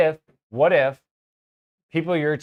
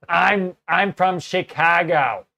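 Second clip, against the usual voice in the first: put-on slow, loud, higher Chicago accent, stretched vowels; overdone Chicago accent; 4 semitones higher, 5 dB louder, slower than usual. Telling the customer where he's from, overdone Chicago accent. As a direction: ham up the Chicago accent, dragging it out. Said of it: "overdoing my accent".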